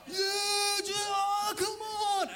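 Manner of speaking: high drawn-out game-day yell; excited fan yelling. Acted. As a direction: holler at the team on TV. Acting out football fans watching Alabama.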